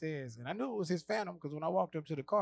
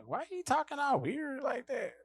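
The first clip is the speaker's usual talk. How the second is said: put-on higher puzzled girl voice; skeptical girl; 11 semitones higher than usual. Houston girl puzzled at Cat, lightly voiced higher. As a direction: ask it high and baffled. Girl mocked in retold story.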